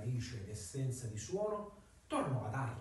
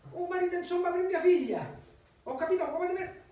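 excited; 13 semitones higher, 7 dB louder than usual. Acted. Lucia asks for husband.

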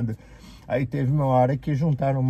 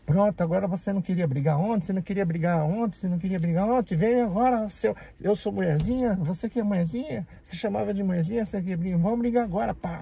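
high-pitched, heated taunting, replayed from the fight; retold angry challenge; 7 semitones higher, faster than usual. Speaker retelling taunting his coworker after knocking him down, high and heated. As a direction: throw the challenge back at him, daring him to fight. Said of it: "Aí".

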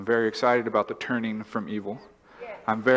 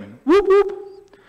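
siren imitation; 8 dB louder, much slower than usual.

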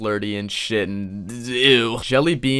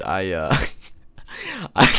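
helpless laughter; 3 semitones lower than usual. Real, not acted. Reactor recalling last video, breaking into real laughter. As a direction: break up mid-sentence.

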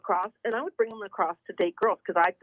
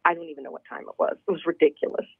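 usual speaking voice

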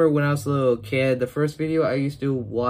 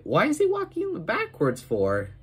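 high baffled squeak; baffled question; 10 semitones higher, 3 dB quieter than usual. Retold or imitated. Kid recalling his own confusion at Joey, high. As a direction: ask it puzzled and high. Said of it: "And I was like".

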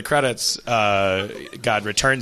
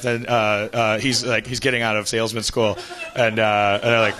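ordinary talk

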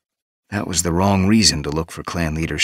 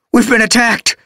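high, loud panicked cry; gasping in fear; 7 dB louder than usual. A narrator's reading. Apprentice gasping out the alarm to his leader, high and loud. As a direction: burst in with the alarm, much higher and louder. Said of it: "he gasped".

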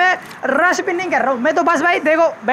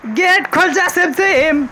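slow, higher-pitched chant-like command; mock magic incantation; 4 semitones higher, slower than usual. Player waiting for the gate to open, mock incantation. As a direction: intone it like a playful spell.

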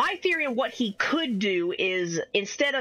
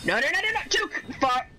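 high, rapid-fire panicked protest; real panic; 5 semitones higher, faster than usual. Real, not acted. Player caught by danger in the game, panicking. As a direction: let it climb high in a sudden panic.

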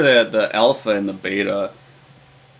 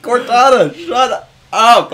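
high, loud fed-up yell; mock exasperation; 11 semitones higher, 8 dB louder, slower than usual. Player yelling at Cortana, exasperated. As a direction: shout the nagging companion down. Acts own annoyed reaction.

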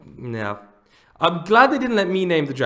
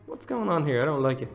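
ordinary talk, nothing marked

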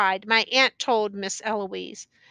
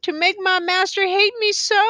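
high, anguished plea; acted tearful lament; crying out; 9 semitones higher, 4 dB louder than usual. A narrator's reading. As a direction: wail the question, pitched up. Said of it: "she cried".

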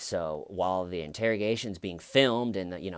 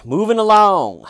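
calling out; 10 semitones higher, 15 dB louder than usual. Real, not acted. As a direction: sing out a transition loudly to move things on. Calls out moving to next section.

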